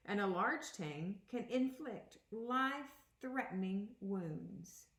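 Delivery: a clear, even read-aloud voice for the class; teacher reading a picture book aloud; reading aloud to children. Acted. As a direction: read the book to the kids, a touch dramatic.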